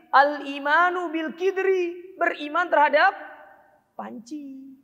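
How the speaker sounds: al-imanu bil kidri said high, slow and loud; mock wrong meaning. Shown as a demonstration. Teacher mocking students who misread kasra. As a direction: ham up the absurd panci reading.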